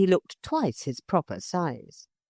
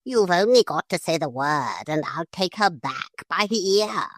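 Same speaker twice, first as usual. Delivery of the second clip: impatient parrot. A narrator's reading. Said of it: "said the bird impatiently".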